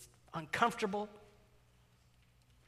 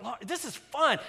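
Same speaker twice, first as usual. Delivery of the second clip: forced cheer, higher and louder; mock cheerful; 8 semitones higher, 8 dB louder than usual. Dad faking cheer for his tired daughter in line, high and loud. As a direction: sell the fun with a pasted-on grin. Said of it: "first it goes the happy stage".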